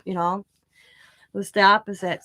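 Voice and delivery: ordinary talk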